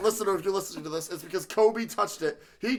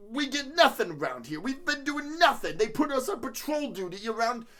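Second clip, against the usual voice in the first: put-on higher-pitched griping Jersey accent; New Jersey cop; 5 semitones higher than usual.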